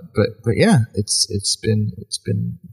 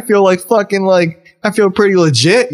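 excited; 8 semitones higher, 3 dB louder than usual. Retold or imitated. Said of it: "I'm like".

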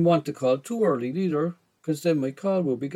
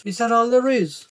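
high, loud mam voice; raised motherly voice; mother's retort; 7 semitones higher, 5 dB louder than usual. A narrator's reading. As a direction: toss it back as the mother, pitched up. Mother's amused reply, voiced.

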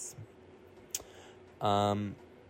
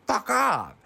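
mock yell; 10 semitones higher, 5 dB louder than usual. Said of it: "Jack swats at him and is like".

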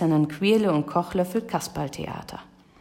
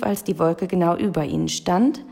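plain reading voice

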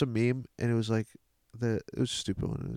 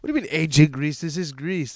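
raised-pitch mock indignation; indignant; 5 semitones higher, 6 dB louder than usual. Leonidas correcting Xerxes about ancient Greece, mock indignant. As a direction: object loudly, playing affronted.

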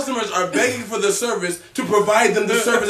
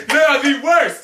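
loud, laughing exclamation; laughing outburst; 5 semitones higher, 4 dB louder than usual. Real, not acted. Friend ranting about Popeyes, loud and laughing. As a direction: declare it loud through a laugh.

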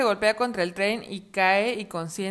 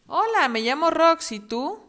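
high, bright cub voice; little fox voice; 8 semitones higher, 8 dB louder than usual. Acted. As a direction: play a cheerful baby fox. Voz del zorrito. (voz aguda de zorrito)

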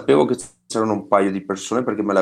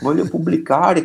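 excited crowd voice; 7 semitones higher than usual. Retold or imitated. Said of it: "e la gente".

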